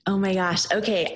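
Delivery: usual speaking voice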